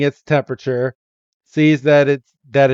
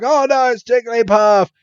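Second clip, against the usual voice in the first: mock alarm; 8 semitones higher than usual. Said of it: "and they're like".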